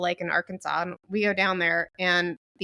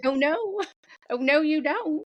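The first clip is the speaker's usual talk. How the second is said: Southern drawl; 8 semitones higher than usual. Retold or imitated. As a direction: play up a Southern lady's drawl. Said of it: "in her full Southern drawl".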